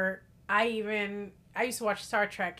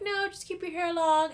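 higher voice quoting the ex; mimicking the ex; 9 semitones higher than usual. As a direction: imitate the ex, pitched up. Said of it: "was just like".

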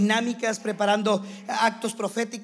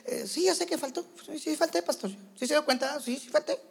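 higher, offhand, couldn't-care-less reply; líder cínico; 4 semitones higher than usual. Retold or imitated. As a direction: shrug it off like a careless leader. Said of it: "se vuelve cínico". (respuesta cínica y despreocupada, más aguda)

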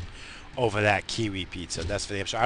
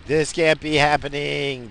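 slower, louder, higher disbelief; mock disbelief; 5 semitones higher, 5 dB louder, slower than usual. Repeating his shocked reaction to the sight, slow and loud. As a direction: stretch it out as if it can't be real.

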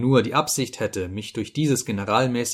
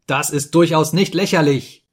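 higher, louder furious yell; angry shout; 6 semitones higher, 7 dB louder than usual. A narrator's reading. Narrator shouts angrily at the laughing Ferfitschkin. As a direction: shout him down in rising anger. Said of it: "schrie ich Ferfitschkin an".

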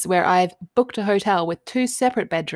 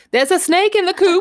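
mum's alarmed cry; 12 semitones higher, 5 dB louder than usual. Retold or imitated. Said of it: "she would just know".

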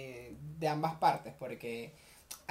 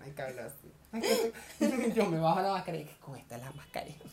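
normal talking voice

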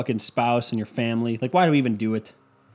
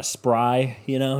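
ordinary talk

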